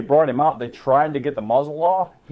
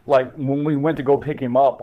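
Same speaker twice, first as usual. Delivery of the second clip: ordinary talk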